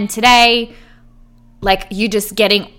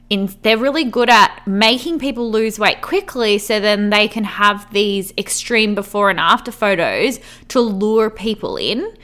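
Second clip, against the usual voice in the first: normal talking voice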